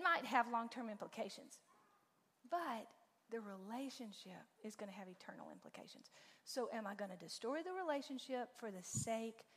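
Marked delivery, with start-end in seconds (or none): 3.3-6.1 soft, hushed emphasis whisper. Real, not acted. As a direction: lean in and whisper the big point. Stressing the key point.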